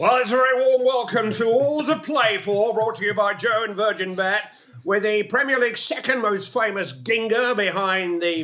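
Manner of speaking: high, loud broadcast announcer opening; commentator intro. Acted. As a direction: open the show like a big match commentator. Commentator opens the show.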